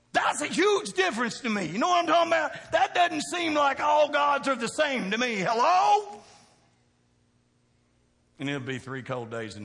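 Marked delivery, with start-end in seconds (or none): 0.1-1.7 louder emphatic. Real, not acted. Repeated louder. 1.7-6.2 high, loud preaching shout. Real, not acted. Preacher shouts to the congregation, high and loud.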